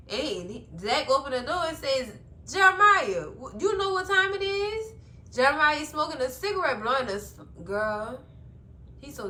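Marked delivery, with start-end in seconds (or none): 2.5-4.9 high, loud mock confrontation; retold indignant challenge. Zach to Jeremiah at the door, indignant challenge. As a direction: confront him, pitched well up.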